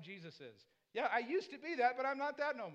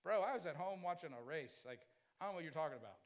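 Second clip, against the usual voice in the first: dismissive; 7 semitones lower, 4 dB quieter than usual. Retold or imitated. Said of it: "I'm like".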